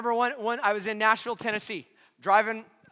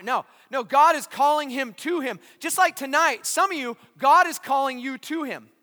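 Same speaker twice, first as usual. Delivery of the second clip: ordinary talk, nothing marked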